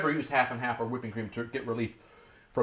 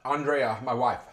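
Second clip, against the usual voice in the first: ordinary talk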